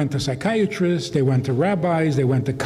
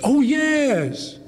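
much higher, overeager "Oh, yes"; mock eager reassurance; 11 semitones higher than usual. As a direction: fake a too-quick yes to the child. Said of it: "You say".

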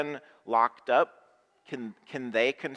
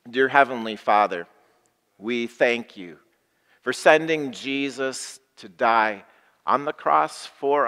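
louder, measured prayer voice for the congregation; pastoral prayer; 6 dB louder than usual. Acted. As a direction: pray over the people, steady and sure. Leads a prayer.

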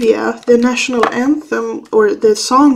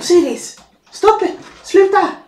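high, sharp real telling-off; scolding the cat; 8 semitones higher than usual. Real, not acted. Owner telling off her cat clawing the doorpost, high and sharp. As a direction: stop it, now, to a naughty cat.

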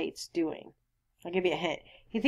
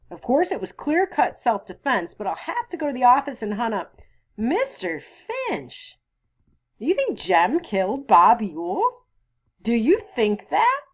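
lightly voiced sheriff, higher and louder; agitated sheriff; 7 semitones higher, 6 dB louder than usual. Sheriff challenging Mister Finch about the killing, mildly agitated. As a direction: press the questions home, a little incredulous.

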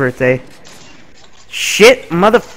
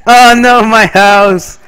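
mock outrage; 6 semitones higher, 6 dB louder than usual.

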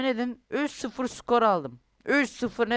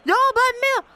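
mock protest; 2 semitones higher, 6 dB louder than usual. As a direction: play the outraged footballer pleading innocence.

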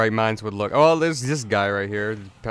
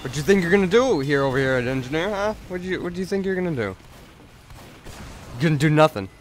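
raised, cocky challenge; mock taunt; 6 semitones higher, 4 dB louder, faster than usual. Gamer confronting the enemy engineer over here, raised mock taunt. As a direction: needle your opponent with playful bluster.

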